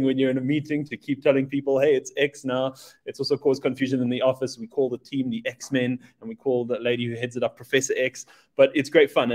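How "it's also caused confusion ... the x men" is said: usual speaking voice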